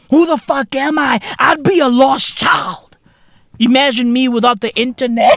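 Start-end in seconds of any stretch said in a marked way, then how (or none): none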